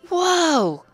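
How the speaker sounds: high, loud astonished gasp; amazed Spike. A narrator's reading. Spike astonished at the fire-breathing pony, high and loud. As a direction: let it blow him away.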